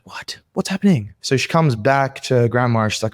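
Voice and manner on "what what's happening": put-on hushed, high, baffled little-girl whisper; puzzled whisper. Little girl whispering at her dog, puzzled.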